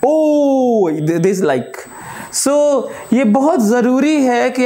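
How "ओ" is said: "ओ" stretched out long; one sustained, expressive "ओ"; long exclamation. Shown as a demonstration. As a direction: draw out "ओ" as an exclamation. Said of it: "आपको louder थोड़ा".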